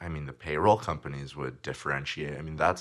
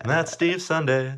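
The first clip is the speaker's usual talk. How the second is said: announcer voice; 12 semitones higher, 7 dB louder than usual. Pretend. Show sign-off in announcer voice.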